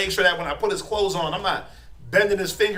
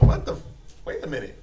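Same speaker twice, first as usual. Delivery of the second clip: normal talking voice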